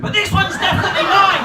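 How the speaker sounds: high, loud possessive declaration; triumphant yell of ownership; loud claim. Retold or imitated. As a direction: insist proudly it's yours. Continues the mine bit.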